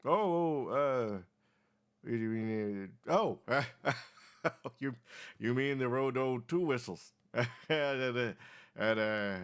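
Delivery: put-on very old man. Old man answers the players.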